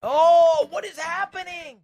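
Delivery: high, loud sudden alarm; startled shock. Real, not acted. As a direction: yelp it out in disbelief. Controller misbehaving in game.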